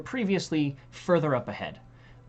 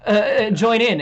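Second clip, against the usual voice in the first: laughing; 6 semitones higher, 10 dB louder, slower than usual. Real, not acted. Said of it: "laugh cry".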